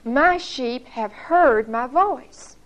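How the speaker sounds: My sheep have heard my voice spoken very slowly; drawn out, deliberate quote; slow, solemn emphasis. Acted. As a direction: give every word its weight. Quoting Jesus's key line.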